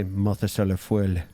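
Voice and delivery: "Mothus à la feuille" French-style, feuille as one syllable "fwil"; attempted French accent. Shown as a demonstration. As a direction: try "Mothus à la feuille" in French, dropping the final "-eh". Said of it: "because French has that weird, like, um, no-, nas-, nasal".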